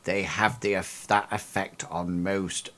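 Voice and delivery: put-on slow, soft-spoken old lady voice, some words whispered; sly reassurance. Old Lady Miko leaning toward Briggs, slow and hushed. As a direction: lean in and murmur it slowly.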